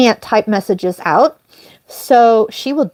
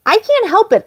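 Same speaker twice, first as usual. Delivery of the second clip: pleading; 10 semitones higher than usual. Retold or imitated. Retold plea to Brian.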